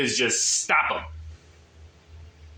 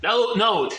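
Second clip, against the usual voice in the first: usual speaking voice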